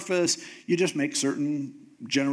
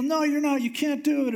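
high-pitched urgent protest, much higher than his own voice; pleading protest; 10 semitones higher than usual. Retold or imitated. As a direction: push back hard, like a man begging God to stop. Moses arguing back at God.

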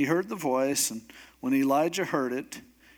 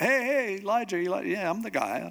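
high-pitched, hurried hail easing down; acted breezy eagerness, starting high; eager calling out; 6 semitones higher, faster than usual. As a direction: call after someone as the keen newcomer. Elisha comes hustling out, calling.

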